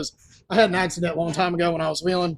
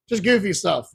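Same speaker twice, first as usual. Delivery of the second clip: ordinary delivery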